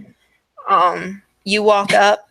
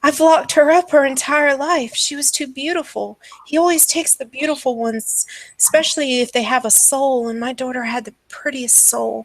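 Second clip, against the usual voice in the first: higher, anguished obsessive mother; distraught madwoman; 6 semitones higher than usual. Acted. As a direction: pour out a mad mother's worry, stumbling over words. Game master plays distraught mother.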